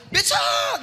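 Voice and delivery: high, loud yell down the street; shouting for a pedicab. Retold or imitated. Older brother yells loudly after a passing pedicab. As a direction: bellow it to stop the becak. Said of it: "Saya mau panggil teriak".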